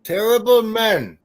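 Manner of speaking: hammed-up wrestler in broken English; Iron Sheik. Taunting a friend as a wrestler, hammed-up Iron Sheik voice. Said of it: "I am Iron Sheik".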